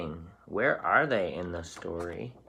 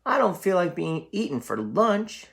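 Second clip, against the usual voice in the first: higher, louder worried goat; frightened cry; 11 semitones higher, 6 dB louder than usual. Acted. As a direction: blurt it out in alarm as the nervous goat. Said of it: "said his middle brother".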